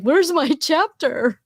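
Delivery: high, slow mock asking voice; playfully voicing the friend's complaint; imitating Kinga. Kinga asking about her missing chapter: high, slow mock voice. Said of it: "She's like".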